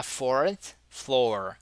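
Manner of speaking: fourth floor slowly, with both r's sounded; pronounced R. Shown as a demonstration. Teacher showing the test phrase, both R's pronounced. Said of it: "você tem dois R's aí".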